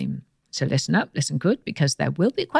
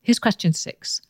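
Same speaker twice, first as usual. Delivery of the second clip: ordinary talk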